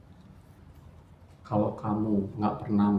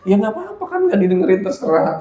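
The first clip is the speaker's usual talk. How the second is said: teasing shrug; 12 semitones higher, 10 dB louder than usual. Retold or imitated. Teasing reply in retold argument.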